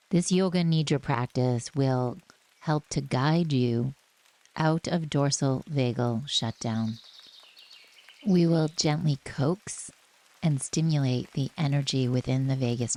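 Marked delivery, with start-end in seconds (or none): none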